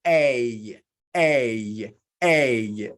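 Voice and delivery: A said long and clear as a model sound; teacher modelling the sound A; vowel demo. Shown as a demonstration. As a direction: hold the vowel A for the class to copy. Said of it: "This sound is what?".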